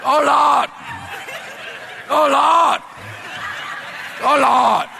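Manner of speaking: loud, very high wail; hammed-up repeated cry of dread; comic horror. Clerk realizing he crossed the CEO, hammed-up panicked wail.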